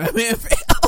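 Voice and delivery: high, laughing delivery; tickled and laughing loudly; cracking up. Real, not acted. Cracking up at his retold scene.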